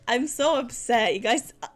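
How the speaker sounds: normal talking voice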